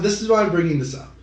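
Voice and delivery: usual speaking voice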